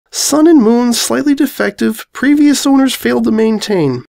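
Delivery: a snarky little dragon groaning at a cheap joke; young dragon. Acted. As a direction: Spike reads the sign and mocks it. Dragon voiced, lines split by narration.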